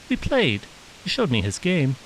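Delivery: put-on high young boy's voice; boy telling his mother about the game; child voice. A narrator's reading. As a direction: innocent little boy reassuring mom.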